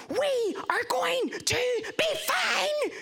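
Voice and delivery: high, slow mock shout of reassurance; slow, high retold shout to a terrified friend; reassuring shout. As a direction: yell it slowly to calm a friend. Grabbing friend on crashing bus.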